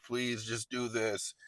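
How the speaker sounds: a small desperate murmur of please; pleading. Retold or imitated. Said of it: "I'm all leaned in".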